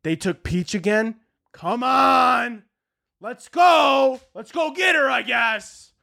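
fed-up Italian plumber, drawn-out grumble building to exasperated yells; grumble, yell in exasperation, then ease off grudgingly; put-upon working man's mock outburst; Mario, upset. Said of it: "he needs to be like".

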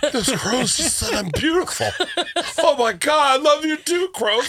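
high giddy mocking voice; mock her swooning at crows. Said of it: "you're just taking those things in".

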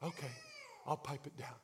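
very soft, meek compliance; small, subdued reply; obedient, piped down. Acted. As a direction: give in quietly. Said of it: "like any good, obedient person".

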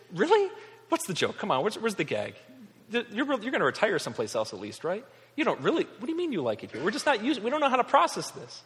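high, rising "really?" then baffled questioning; incredulous disbelief. Acted. Locals reacting to newcomers who stay, incredulous. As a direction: play the baffled local who can't process it.

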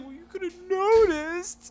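very high, slow, drawn-out wail; hammed-up tearful whine, imitating a kid; mock crying child. Daughter complaining dad hadn't noticed, mock crying. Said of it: "She's like".

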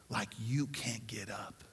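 quiet preacher's whisper; hushed whisper. Acted. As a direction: drop to a whisper. Dramatic hush in the sermon.

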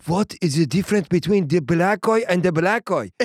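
baffled, hammed-up Persian accent. Host voices his baffled Persian fans, Persian accent. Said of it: "my Persian fans are going".

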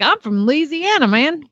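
lightly put-on Louisiana accent; Southern accent. Horse owner telling trainer where he's from, Southern accent. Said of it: "he has a Southern accent".

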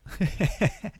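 a quick high laugh; short laugh. Real, not acted. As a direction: laugh it off lightly. Laughs at own advice. (petit rire aigu)

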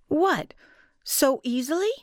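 high, startled disbelief; surprised. A narrator's reading. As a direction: catch it in sudden disbelief. Narrator startled by offer.

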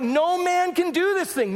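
high, slow, mock desperate plea; pleading wise men. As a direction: beg the king, hammed-up panic.

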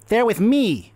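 high, ringing declaration; ringing gnome captain. Acted. Young gnome claiming the party, high and confident. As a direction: ring it out across the room. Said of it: "this voice rings out".

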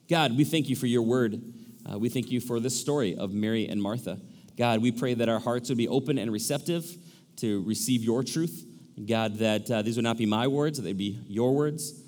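low, soft prayer voice; earnest, subdued pastor's prayer. Real, not acted. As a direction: pray aloud, hushed and low. Leads a prayer.

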